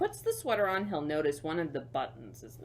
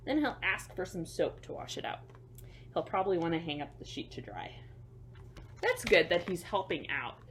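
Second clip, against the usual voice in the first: ordinary delivery, nothing marked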